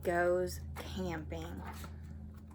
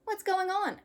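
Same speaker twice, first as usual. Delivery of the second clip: put-on higher curious little boy; little brother; 12 semitones higher than usual. Arthur asking about the car, high little boy voice. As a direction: squeak it like a small kid.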